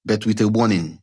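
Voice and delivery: plain reading voice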